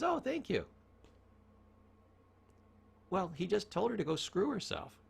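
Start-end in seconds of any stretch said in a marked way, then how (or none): 0.0-0.7 high, pleased thank-you; delighted toll lady. Retold or imitated. Toll lady thanking the driver, high and pleased. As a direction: play the flattered toll lady. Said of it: "And she says".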